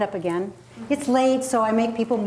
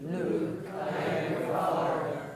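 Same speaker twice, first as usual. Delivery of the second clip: slow, low, softer villain voice; Darth Vader; 5 semitones lower, 6 dB quieter, slower than usual. Shown as a demonstration. Coach leads group down to lowest range, Darth Vader voice. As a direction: sink to your lowest comfortable note, drawn out. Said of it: "to your Darth Vader voice".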